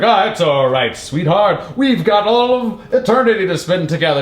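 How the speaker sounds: hammed-up superhero sweet-talk; consoling cartoon hero. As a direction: play a cartoon hero soothing his sweetheart. Voices superhero consoling the villain.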